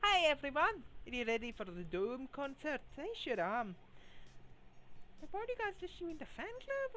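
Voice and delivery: high, lightly put-on Scottish accent for the idol; wistful idol in a mock Scottish accent; bad Scottish accent. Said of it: "her Scottish accent and a very bad one at that".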